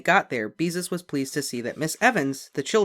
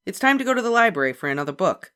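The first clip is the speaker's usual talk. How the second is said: put-on higher, louder bossy older sister; Beezus, big sister; 7 semitones higher, 7 dB louder, faster than usual. A narrator's reading. Big sister calling Ramona, bossy and loud. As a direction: hurry the little sister along.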